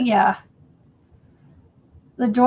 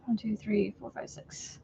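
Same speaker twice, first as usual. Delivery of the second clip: whispering the count; whispered counting; 13 dB quieter than usual. Real, not acted. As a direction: count under your breath, whispering. Counting stitches under breath.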